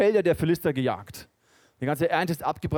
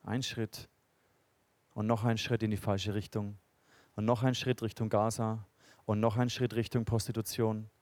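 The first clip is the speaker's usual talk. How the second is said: leise eindringlich; 5 semitones lower, 6 dB quieter than usual. Real, not acted. Eindringlicher Predigtschluss.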